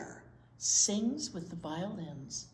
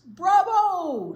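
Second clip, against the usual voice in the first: much higher, much louder cheer; yelled bravo; 12 semitones higher, 15 dB louder than usual. Acted. Audience member cheering at the opera's end, yelling loudly. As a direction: shout it across the hall. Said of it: "somebody yells".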